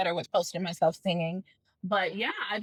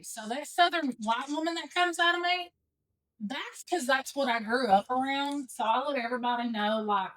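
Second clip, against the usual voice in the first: Southern accent; 4 semitones higher than usual. Shown as a demonstration. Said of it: "Southern woman".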